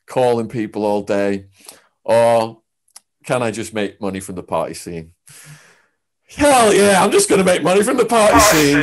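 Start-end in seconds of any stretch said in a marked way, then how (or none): 6.3-8.8 high, loud, fast cocky cheer; fast, excited self-assured shout; cocky hell yeah. Retold or imitated. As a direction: whoop it like a reckless young hustler. His cocky decision, voiced.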